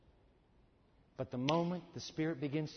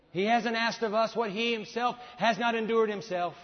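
loud, higher sermon push, slowing on key lines; preaching at full force; 4 semitones higher, 7 dB louder than usual. Acted. Preacher warning the church, loud and urgent. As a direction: thunder the narrow way.

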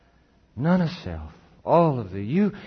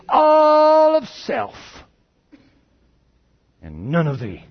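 much higher and louder, drawn out slowly; proud declaration; 9 semitones higher, 8 dB louder, slower than usual. Acted. Poem speaker proudly telling Jesus, loud and high. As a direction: proclaim it proudly, stretching every word. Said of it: "when I proudly said to Jesus".

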